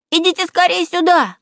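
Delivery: put-on high-pitched child calling others over; eager boy's shout; excited calling. Boy calls family over.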